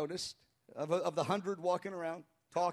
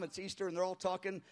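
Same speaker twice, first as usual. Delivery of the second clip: ordinary delivery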